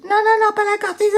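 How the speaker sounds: high pleading mother voice; worried mother. Retold or imitated. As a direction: beg like an alarmed mother. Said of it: "Sa mère a dit".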